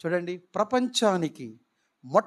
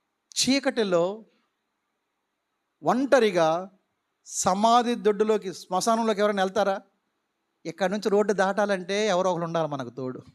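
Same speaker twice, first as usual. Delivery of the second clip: ordinary talk, nothing marked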